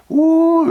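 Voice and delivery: high, loud "woo" of rushing blood; imitated whoosh. Patient imitating blood surging, a high whoop. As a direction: swoop it out like a surge.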